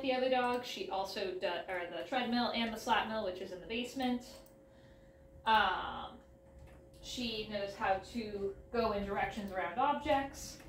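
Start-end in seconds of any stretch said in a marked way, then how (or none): none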